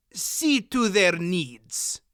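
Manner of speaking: put-on slow, harsh accented order; harsh curt order. A narrator's reading. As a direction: bark it coldly with a thick accent. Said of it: "said shortly".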